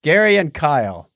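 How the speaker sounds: ordinary delivery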